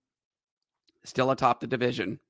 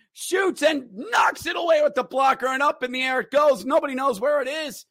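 higher, urgent hockey call; excited play-by-play; 10 semitones higher than usual. Real, not acted. Announcer calling chaos in front of the net, excited. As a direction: call the scramble in front, rising.

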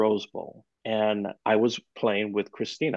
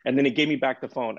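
ordinary talk, nothing marked

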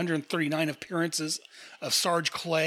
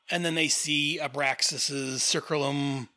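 ordinary delivery

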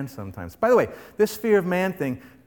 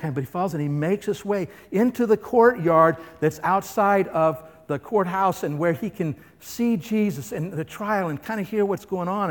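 ordinary talk, nothing marked

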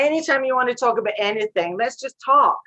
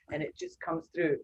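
usual speaking voice

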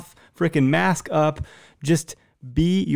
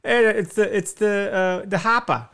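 high-pitched, hammed-up Boston accent; attempted Boston accent; 5 semitones higher than usual. Joker attempts Boston catchphrases for guests, bad Boston accent. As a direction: fumble through a badly faked Boston accent. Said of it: "I can't do an accent".

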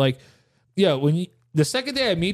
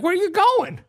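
put-on high, wheedling old man voice; high-pitched old neighbor impression; 13 semitones higher than usual. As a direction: imitate an old man asking sweetly, way up high. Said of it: "He's like".

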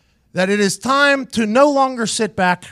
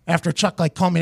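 ordinary delivery

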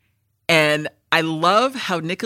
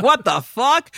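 mock shocked; 7 semitones higher, 4 dB louder, slower than usual. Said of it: "you're like".

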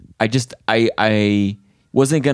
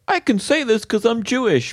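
much higher, put-on voice; mock disclaimer; 13 semitones higher than usual. Comic mimics having to justify a joke, high mock disclaimer. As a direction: imitate someone excusing a joke in advance.

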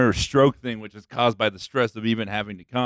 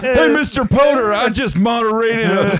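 high, loud, gleeful taunting; mocking taunt; 13 semitones higher, 7 dB louder than usual. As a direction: jeer at him, high and loud. Mock taunting Mister Potter.

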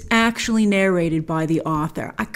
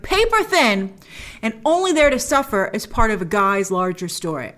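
usual speaking voice

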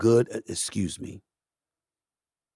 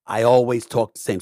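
ordinary delivery

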